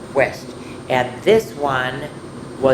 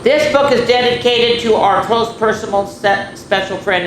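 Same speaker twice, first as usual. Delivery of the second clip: grand read-aloud; 7 semitones higher, 5 dB louder than usual. Acted. Reading the dedication aloud.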